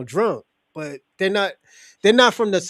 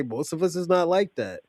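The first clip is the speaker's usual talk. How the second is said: ordinary talk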